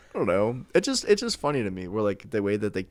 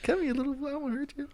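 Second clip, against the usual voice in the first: mock-sweet high voice; faster than usual. Repeats [name] impression.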